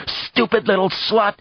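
grating fury. A narrator's reading. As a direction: spit it out between gasps. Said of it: "words grating out of her own throat".

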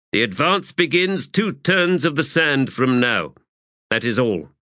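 officer briefing a whole company. A narrator's reading. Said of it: "and then raised his voice".

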